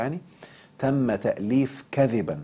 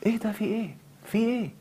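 incredulous; 11 semitones higher, 3 dB quieter than usual. Real, not acted. Mocking TV crying scenes.

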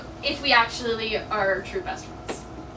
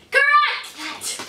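1 excited; 12 semitones higher than usual. Real, not acted. Announcing right answer.

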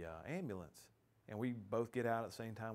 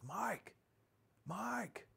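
high, piercing call of a name; shouting the name twice, high and urgent; retold yell; 12 semitones higher than usual. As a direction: yell his name to wake him. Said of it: "he yells and says".

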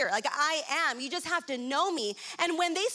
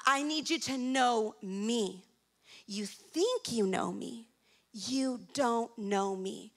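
slow, lower, deliberate rebuke; slow, pointed; 5 semitones lower, slower than usual. Acted. Jesus telling listeners they don't know him, slow and pointed. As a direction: space it out and let it sink in.

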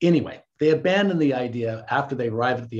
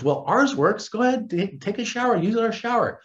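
higher-pitched imitation of an obliging host; raised voice, urging her along; acted eager offer; 7 semitones higher than usual. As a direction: offer it brightly, as if retelling.

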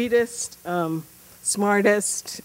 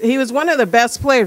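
normal talking voice

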